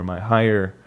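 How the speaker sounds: ordinary talk, nothing marked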